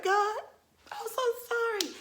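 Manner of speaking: very high mock-tearful voice; whimpered apology.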